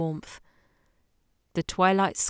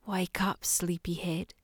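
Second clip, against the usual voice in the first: whispering; 8 dB quieter than usual. Acted. Lover waking him in bed.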